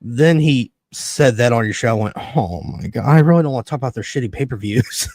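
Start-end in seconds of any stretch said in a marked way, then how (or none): none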